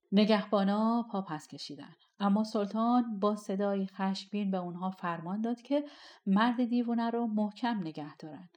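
usual speaking voice

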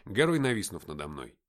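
plain reading voice

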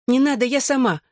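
ordinary delivery

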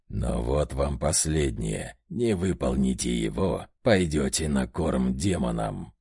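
низкий, медленный голос демона; угрожай низко и тяжело; зловещий демон. A narrator's reading. Одержимый угрожает Власову. (низкий зловещий голос одержимого)

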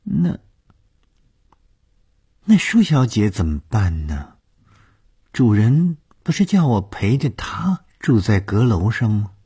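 high-pitched, mildly reluctant questioning; hesitant excuse. Acted. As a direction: try politely to get out of it. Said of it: "她试着想推脱". (试着推脱地问)

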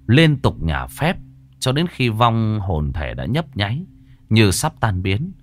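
ordinary delivery, nothing marked

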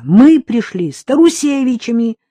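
high and loud, frantic reproach; desperate shout. Acted. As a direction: hurl it at him in anguish. Frantic woman keeps shouting.

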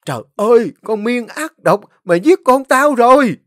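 very high, loud wailing cry; wailing accusation. A narrator's reading. Mother seeing wounded son, loud wailing accusation. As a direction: wail it out at her. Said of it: "khóc bù lu bù loa tru tréo".

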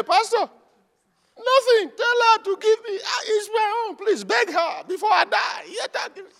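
high shouted begging; mock desperate shout. Husband begs pastor before he dies, high desperate shouting. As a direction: shout and beg like he's dying. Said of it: "The other person say".

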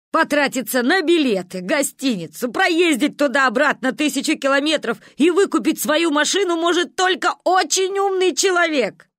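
rising, high, loud self-mockery; mock your own foolishness. A narrator's reading. Father mocks himself, laughing.

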